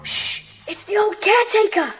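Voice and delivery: sharp whispered warning; boy warning Jack about the snoring caretaker. Acted. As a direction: hush him so the sleeper stays asleep. Sneaking past the sleeping caretaker.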